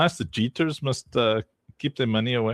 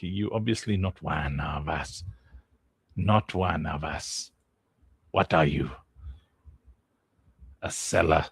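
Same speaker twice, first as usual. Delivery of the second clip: much lower, softer gravel-free deep put-on voice; mock tough guy; 9 semitones lower, 4 dB quieter than usual. Streamer imitates himself shaming a seller, low and mocking. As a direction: drop low like a gatekeeper sneering. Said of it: "then I'm like".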